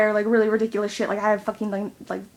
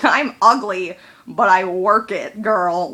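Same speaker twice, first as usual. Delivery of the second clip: louder, slow, sassy strut; sassy pep talk; 7 dB louder, slower than usual. Retold or imitated. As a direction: own it like a diva.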